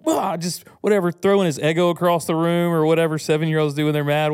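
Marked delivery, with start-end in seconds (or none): 0.1-0.4 put-on higher, whiny little boy groaning; frustrated kid.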